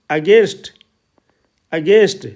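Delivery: "against" with a long Hindi ए in the second syllable; teacher demonstrating the ए sound in "against"; vowel as Hindi ए. Shown as a demonstration. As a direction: model "against" slowly as a-GEST, stressing the ए vowel. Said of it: "तो ये ए की ध्वनि में".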